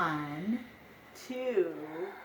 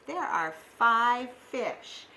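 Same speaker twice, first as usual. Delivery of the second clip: ordinary talk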